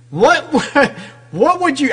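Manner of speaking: high, laughing disbelief. Real, not acted. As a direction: laugh through the question. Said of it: "I'm laughing".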